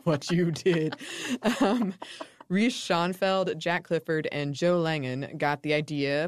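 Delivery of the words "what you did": slow, teasing menace; mock ominous. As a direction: drag it out like a spooky threat. Joking mock threat.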